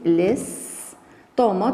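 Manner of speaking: very slow "estomac", long s, open a ending; silent final C. Shown as a demonstration. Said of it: "C ne se prononce pas". (estomac très lentement, C muet)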